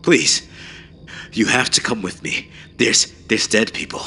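high, stumbling plea, only mildly frantic. A narrator's reading. Runner begs officer to come, mildly frantic. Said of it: "I yelled frantically".

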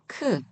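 크 short and high with a puff; aspirated 크. Shown as a demonstration. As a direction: release the k of 크 with air. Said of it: "이렇게 읽으시면 되시고요". (거센소리로 짧게 크)

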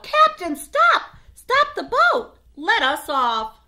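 very high, loud, frantic cries; urgent shout. Acted. Children begging the captain to stop the boat, very high and loud. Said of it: "The children all shout".

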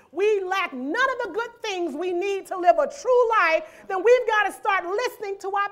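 loud, driving preacher's build; full-force preaching. Acted. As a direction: push it out to the congregation.

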